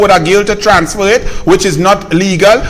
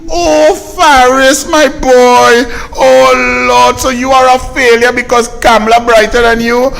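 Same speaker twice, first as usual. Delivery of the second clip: mock lamenting mother; 3 semitones higher than usual. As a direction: wail over your poor boy in mock sympathy. Mock mother lamenting over her son.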